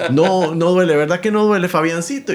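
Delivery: acted loud reassurance; insistent grandfather. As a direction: imitate grandpa firmly talking a child out of pain. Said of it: "recuerdo que él me dice".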